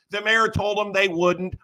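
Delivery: normal talking voice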